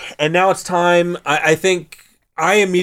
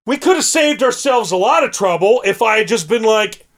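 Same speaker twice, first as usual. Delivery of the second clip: ordinary talk, nothing marked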